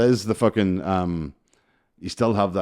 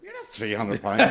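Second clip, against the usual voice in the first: slow, drawn-out mock disbelief; disbelieving aunt; 3 semitones higher than usual. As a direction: imitate an aunt slowly contradicting him. Aunt retold disbelieving the guess.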